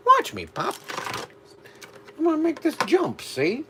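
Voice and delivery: put-on high old-timey gangster talk; nineteen twenties newsreel voice; 1920s voice. Shown as a demonstration. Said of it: "I gotta do that in a nineteen twenties voice".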